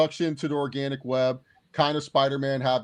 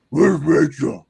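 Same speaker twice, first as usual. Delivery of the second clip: deepened hero voice; 9 dB louder, slower than usual. Retold or imitated. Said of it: "Like, just deepening your voice".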